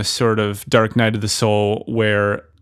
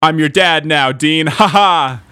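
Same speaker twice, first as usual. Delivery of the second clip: louder, higher movie-star impression; hammed-up gloating, pitched up; Michael Caine; 5 semitones higher, 4 dB louder than usual. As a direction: crow it triumphantly with a mock laugh. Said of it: "Michael Caine's like".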